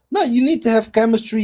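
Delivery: usual speaking voice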